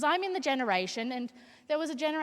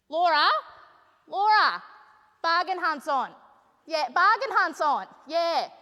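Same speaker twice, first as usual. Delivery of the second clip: impression of mum; 4 semitones higher, 5 dB louder than usual. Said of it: "her on these old leather couches, just".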